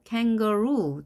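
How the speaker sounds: "kangaroo" with the last syllable ROO stressed; kan-ga-ROO, punching and stretching the end; final stress. Shown as a demonstration. Teacher shows where stress falls, "kangaroo" stressed on ROO. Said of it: "강세가 뒤에 놓이게 됐습니다".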